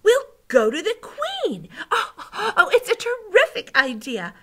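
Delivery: high, loud, delighted outpouring; thrilled child character; excited. A narrator's reading. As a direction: pitch the brilliant idea breathlessly eager. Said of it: "in a voice filled with excitement".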